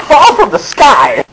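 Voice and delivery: high, slow, laughing through it; cracking up at the silly twist. Real, not acted. Narrator telling the children, laughing.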